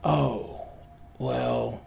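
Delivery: soft, hesitant stranger voice; barely above a whisper. Acted. Weird guy asked for his name, hushed and hesitant. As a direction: let it drop low and hushed. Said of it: "in this voice that's barely above a whisper".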